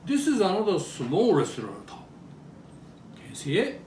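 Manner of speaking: ordinary talk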